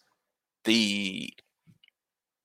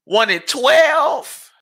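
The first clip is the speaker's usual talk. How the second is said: much higher, louder, slow groaning count; anguished wail; 6 dB louder, much slower than usual. Real, not acted. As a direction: let the pain of one and twelve out. Despair over losing season.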